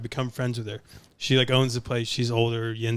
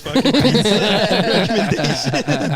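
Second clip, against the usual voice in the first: laughing; 7 semitones higher, 7 dB louder than usual. Retold or imitated. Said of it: "I'm like".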